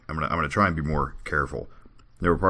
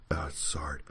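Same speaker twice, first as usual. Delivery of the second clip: ordinary delivery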